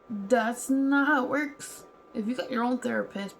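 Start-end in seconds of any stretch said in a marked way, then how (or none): 0.1-1.8 slow and louder, every word landed; emphatic. Real, not acted. Ranting to viewers about the therapist, slow emphatic lines. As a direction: lay down the law, word by word.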